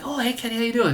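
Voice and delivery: high, chirpy hello; bright greeting. Retold or imitated. Greeting Kenny as he shows up, high and chirpy. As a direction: greet him up high like a pleasant surprise.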